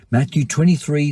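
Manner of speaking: ordinary talk, nothing marked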